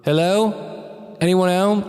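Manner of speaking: echoing as if in a big hall; hall reverb. Shown as a demonstration. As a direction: call out into an empty hall. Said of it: "I can make it sound like I'm in a hall".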